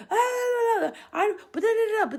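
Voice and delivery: high, loud mock whine of excuses; whining kids. Kids protesting at mom: high mock whine. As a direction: mimic kids babbling excuses. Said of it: "They were like".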